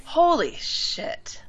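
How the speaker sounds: slow, drawn-out, high-pitched amazement; stunned. Real, not acted. Host hears friend hasn't heard the song, stunned. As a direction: stretch it out in disbelief.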